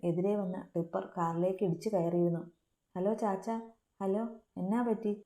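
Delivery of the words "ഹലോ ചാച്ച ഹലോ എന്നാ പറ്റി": plain reading voice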